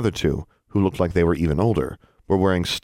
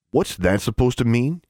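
higher, prickly challenge; snarl; 5 semitones higher than usual. A narrator's reading. David challenges the boy's insult, a mild snarl. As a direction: throw it back with mild anger. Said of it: "he snarled".

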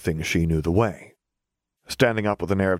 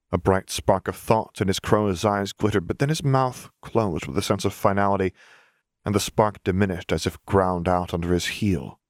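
ordinary delivery, nothing marked

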